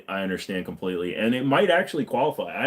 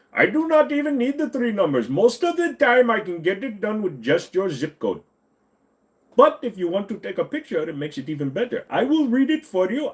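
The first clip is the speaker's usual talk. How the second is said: put-on Indian accent scammer; 9 semitones higher than usual. Scammer sketch resumes.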